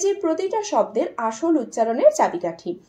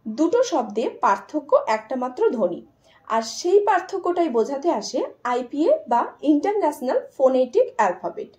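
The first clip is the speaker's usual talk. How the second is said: ordinary talk, nothing marked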